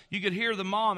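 ordinary talk, nothing marked

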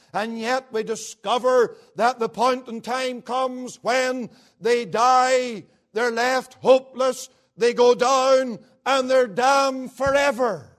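loud, rising preacher's warning; slow, loud and rising to damned forever; full-force preaching. Acted. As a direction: drive home the doom from the pulpit.

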